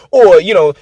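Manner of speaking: ordinary delivery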